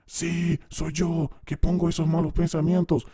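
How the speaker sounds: a hint of a meek confessing spirit; demon confessing.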